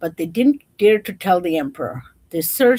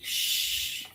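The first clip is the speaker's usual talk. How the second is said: hush; 9 dB quieter than usual. Real, not acted. Hushing for the song.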